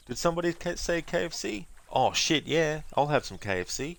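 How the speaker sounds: high, bright sing-song slogan; cheery ad voice. Retold or imitated. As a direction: switch to a chirpy commercial tone. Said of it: "It's like".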